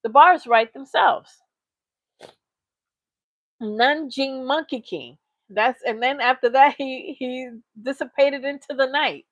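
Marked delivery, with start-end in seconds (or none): 3.6-5.2 slow mocking drawl on Nanjing Monkey King; sneering slow repeat of Nanjing Monkey King. Real, not acted.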